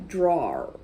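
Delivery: drawer said "dror", no e sound; drawer, sliding from w to r, "dror"; drawer without e. Shown as a demonstration. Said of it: "Você não vai ler o som do E".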